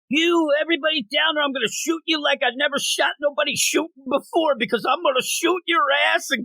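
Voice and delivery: high, hammed-up gunman threat; over-the-top robber. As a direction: bluster like a cartoon bank robber. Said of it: "dialogue from these people".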